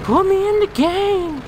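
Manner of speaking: high, loud, slow drawn-out cry; drawn-out shout. Pretend. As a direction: stretch it out like a chant. Repeated louder, slower.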